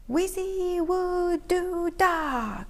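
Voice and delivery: slow, drawn-out magic word; spell cry. A narrator's reading. Keith slowly casting his made-up spell. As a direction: cast it slowly like a wizard. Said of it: "cried Keith".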